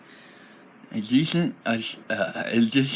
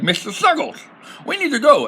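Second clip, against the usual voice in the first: high goblin voice, louder at the end; silly voice; 13 semitones higher, 4 dB louder than usual. Acted. Goblin merchant hurrying his mule along, high silly voice. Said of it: "unable to do the silly voice".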